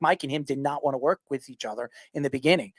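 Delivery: usual speaking voice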